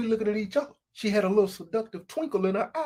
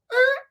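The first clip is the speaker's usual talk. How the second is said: high door creak squeak; 5 dB louder than usual. Acted.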